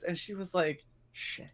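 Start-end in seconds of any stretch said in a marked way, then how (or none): 1.1-1.5 a barely voiced, defeated "Shit."; hushed curse. Nervous trainee realizing her mistake, a hushed curse. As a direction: drop it under your breath in dismay.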